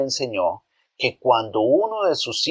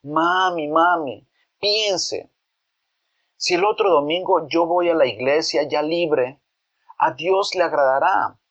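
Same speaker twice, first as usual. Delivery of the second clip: put-on higher boy voice turned coaxing and pleading; pleading softly; 6 semitones higher than usual. Boy coaxes his mother after his outburst, pleading. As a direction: wheedle mami into saying yes. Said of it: "bajando su tono y como suplicando".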